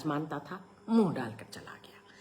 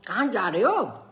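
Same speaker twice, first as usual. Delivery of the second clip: a higher, louder aunt's voice; fond aunt; 7 semitones higher, 5 dB louder than usual. A narrator's reading. Aunt offering bananas and sweets to her nephew, coaxing. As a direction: coax the child with a lifted voice.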